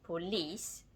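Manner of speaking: "police" with the second syllable stressed and drawn out; "police" with weight on its last syllable; stress on final syllable. Shown as a demonstration. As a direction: say "police" leaning on a long final "lice". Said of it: "the stress falls on the final syllable".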